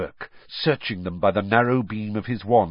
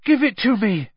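Harry voice; 9 semitones higher, 5 dB louder than usual. Acted. Harry struggling in water.

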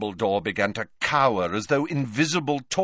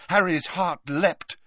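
ordinary delivery

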